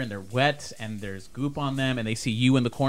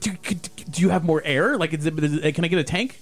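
anxious; 6 semitones higher than usual. Acted. Scared survivor begs for air.

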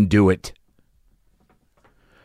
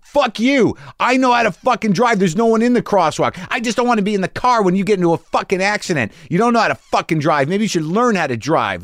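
much higher hammed-up shouting match; mock angry yell; 11 semitones higher than usual. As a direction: retell the fight at a shriek. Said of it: "I'm like".

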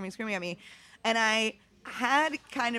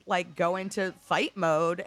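ordinary talk, nothing marked

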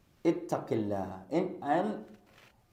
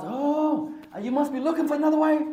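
high, teasing wife voice; mock suspicious wife; 10 semitones higher than usual. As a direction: needle him like a suspicious wife. Wife suspects husband again.

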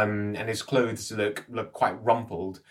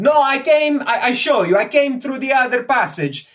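put-on high, loud accented character voice; flustered, loud foreign scholar; excitable foreign Egyptologist, pleading; 9 dB louder than usual. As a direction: insist eagerly in a high foreign voice. Ippolito explains himself.

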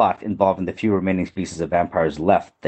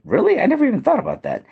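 surprised. Retold or imitated. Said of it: "and I said".

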